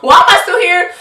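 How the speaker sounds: loud, high-pitched mock despair; hammed-up exasperated yell; exasperated outburst. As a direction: cry it out, fed up with yourself. Acted exasperation with herself.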